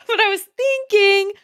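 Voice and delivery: high, slow laughing words. Real, not acted. Laughing at own mistake.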